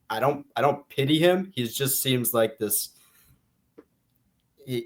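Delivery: normal talking voice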